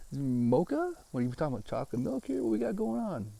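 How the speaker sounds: high squeaky confused voice; confused high mock voice. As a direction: play it baffled, pitch jumping up. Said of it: "I'm like".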